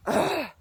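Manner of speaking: high, wordless ugh of frustration; short fed-up ugh; exasperated groan. Real, not acted. Disgust after retelling mom's reaction.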